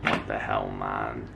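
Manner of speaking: dropped way down, soft and gruff; mock deep voice. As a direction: play your own shocked self in a deep, low voice. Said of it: "And I was just like".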